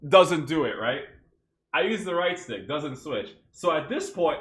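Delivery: normal talking voice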